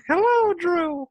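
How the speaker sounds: put-on high, prim little old lady voice; sweet old lady. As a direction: answer like a polite grandma. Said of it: "and the lady's just like".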